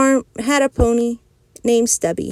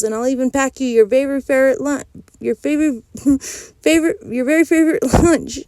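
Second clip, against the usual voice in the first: laughing; 5 semitones higher, faster than usual. Real, not acted. Reader cracks up mid-line.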